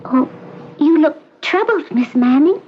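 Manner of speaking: put-on high, light girlish chatter; cheerful young patient. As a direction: reassure the visitor sweetly. Recovered patient chatters brightly.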